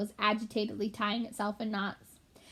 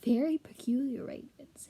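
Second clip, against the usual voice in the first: whisper; 3 semitones higher than usual. Acted. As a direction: keep it hushed and secretive. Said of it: "still in a whisper".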